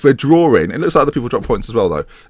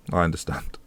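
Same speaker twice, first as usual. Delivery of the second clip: breathy whispered aside; 7 semitones lower, 11 dB quieter than usual. Real, not acted. A whispered aside to the viewers admitting the jokes are weaker. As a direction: say it under your breath.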